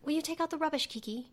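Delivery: imitating mom's pompous Queen's English; high, soft, posh British request; posh British accent. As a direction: play a pompous British mother. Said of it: "my mom used to have a thick British accent".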